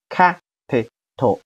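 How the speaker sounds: capital slowly split into three beats, ca-pi-tal; teacher's slow sounding-out of capital; syllable by syllable. Shown as a demonstration. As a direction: say capital with gaps between syllables. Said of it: "好，连起来".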